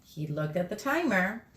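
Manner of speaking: usual speaking voice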